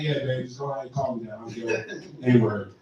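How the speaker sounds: low, soft, fast mock dad voice. Mocking dad telling mom, low soft mock voice. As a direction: murmur it like a smug dad.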